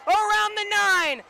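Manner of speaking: fast, loud and high; excited play call. Real, not acted. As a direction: call the play rising to a peak. Play call, first and goal.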